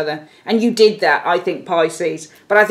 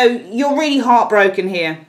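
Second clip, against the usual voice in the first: ordinary delivery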